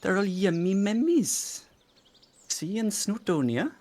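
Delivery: slow, high, lilting Welsh accent. Shown as a demonstration. Friend answers a chat request, Welsh accent. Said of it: "say yummy mummy in a Welsh accent".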